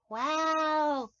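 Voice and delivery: ordinary delivery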